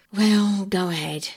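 grudgingly. A narrator's reading. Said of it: "said Perks grudgingly".

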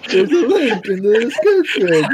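really laughing through the words; helpless laughter. Real, not acted. Speaker breaks up laughing mid plug.

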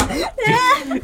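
laughing. Real, not acted. Laughs at the word game.

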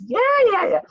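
high, loud yes-yes-yes to keep the peace; mock agreeing. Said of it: "Never disagreed. Just".

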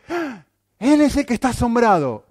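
high, loud gasp of mock astonishment; preacher voicing Simón's amazement, mock astonished. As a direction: play Simón gaping in wonder, hammed up. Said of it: "y ahora nos dice".

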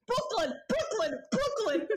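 high, slow put-on Brooklyn accent; trying out a hammed-up Brooklyn accent. Shown as a demonstration. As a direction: drag out a mock Brooklyn accent. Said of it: "Just say Brooklyn".

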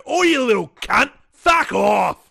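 high, loud pretend telling-off; acted abusive outburst; mock angry shout. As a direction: yell it like you've snapped at a kid. Acting out snapping at a kid.

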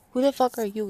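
playfully acted outburst; mock confrontational. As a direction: call out a stranger, half-joking. Acted challenge to unknown neighbours.

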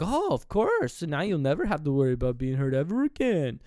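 high-pitched, hammed-up false comfort; mock reassurance. Vasily to the tortured captive, mockingly reassuring. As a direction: reassure them with exaggerated, swooping insincerity.

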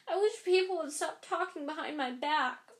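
high, mildly tearful complaint; choked with tears. A narrator's reading. Ghost Myrtle accuses the visitors, mildly choked with tears. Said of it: "in a voice choked with tear".

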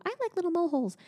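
high, small-bird voice; Little Bird voice. Retold or imitated. Mom quoting a children's book character. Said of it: "Little Bird would go".

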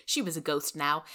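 ordinary delivery, nothing marked

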